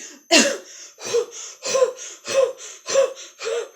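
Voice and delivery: repeated high, effortful puffs; huffing and puffing. Acted. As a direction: puff rhythmically like a worn-out dragon. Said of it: "he huffs and he puffs".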